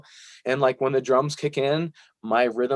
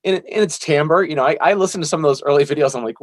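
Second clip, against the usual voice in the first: normal talking voice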